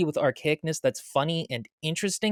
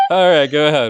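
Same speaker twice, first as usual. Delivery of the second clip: laughing hard; 9 semitones higher, 6 dB louder than usual. Real, not acted. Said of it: "laughing fit".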